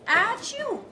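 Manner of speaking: a teacher acting out the cow sneezing; mock sneeze. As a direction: fake a sneeze for the kids. Retelling cow's sneeze.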